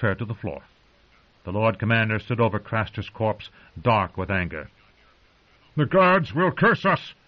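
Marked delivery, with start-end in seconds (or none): 5.8-7.1 raised, higher and slower warning; cried out. Acted. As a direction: call out in horror and anger. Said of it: "he cried".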